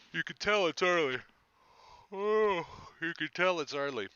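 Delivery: talking through a yawn. Real, not acted. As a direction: yawn out the words sleepily. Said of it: "'Cause I'm still yawning over here".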